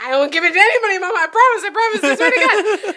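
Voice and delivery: high, loud, laughing promise to mom; pleading promise. Retold or imitated. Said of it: "And I'm like".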